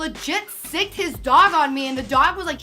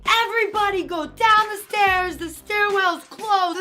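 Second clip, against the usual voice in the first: retold officer yell; 5 semitones higher than usual. Officer at top ordering students, higher mock shout. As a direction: call it down like a cop. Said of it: "he was yelling".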